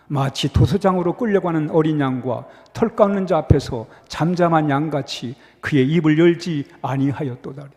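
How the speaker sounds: low, measured reading of the verse; solemn Bible recitation; scripture reading. Acted. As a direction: read it out together like a congregation. Reads scripture aloud with congregation.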